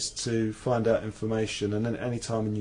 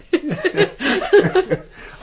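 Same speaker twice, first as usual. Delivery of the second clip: laugh; 11 dB louder than usual. Real, not acted. Laughs at his own joke.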